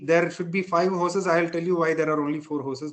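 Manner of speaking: usual speaking voice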